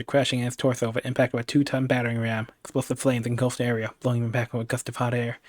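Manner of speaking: ordinary delivery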